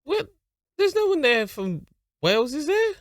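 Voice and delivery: where did that come from; surprised disbelief. Real, not acted. Reacting to the Wales segment, high and disbelieving. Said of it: "surprised at the Wales segment".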